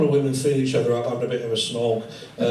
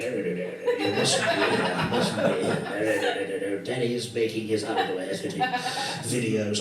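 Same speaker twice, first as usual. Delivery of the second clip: put-on lower, slow hushed old boy shooing the cat; hushed old man; 5 semitones lower, 4 dB quieter than usual. Old man leaning in to shoo the cat, slow hushed voice. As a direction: shoo the cat away in a low murmur. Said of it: "this old boy will lean in".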